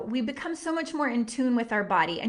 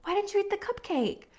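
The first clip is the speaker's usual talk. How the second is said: higher, softer tender question; tender mother; 8 semitones higher, 4 dB quieter than usual. Retold or imitated. Mother asks her daughter gently, tender and soft. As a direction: ask gently, holding her little face. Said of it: "cup her little face and say".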